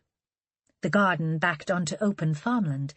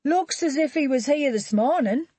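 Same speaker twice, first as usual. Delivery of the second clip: higher, louder man's voice for Charlie; Charlie's voice; 8 semitones higher, 4 dB louder than usual. Acted. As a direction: voice Charlie as a distinct character. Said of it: "Charlie said".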